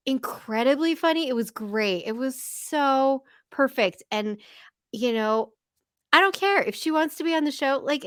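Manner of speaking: usual speaking voice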